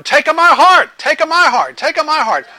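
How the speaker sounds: high, loud eager chant of the crowd; crowd shouting. Retold or imitated. Crowd in Saint Peter's Square begging the Pope, loud shouted chant. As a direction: yell it like thousands begging to be chosen.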